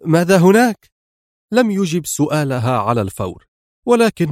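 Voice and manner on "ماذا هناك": higher, louder startled call; cried-out question; exclaimed question. A narrator's reading. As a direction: call the question out, pitched up sharply. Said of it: "وهتفت".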